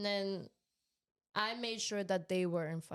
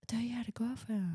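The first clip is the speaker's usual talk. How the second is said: whispering; 2 semitones higher than usual. Real, not acted. Stunned reaction to news.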